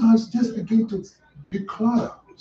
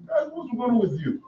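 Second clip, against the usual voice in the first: soft, higher-pitched mild questioning; acted calm question, hushed; unpanicked; 6 semitones higher, 8 dB quieter than usual. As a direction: ask it softly, without any panic. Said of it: "He, he didn't panic".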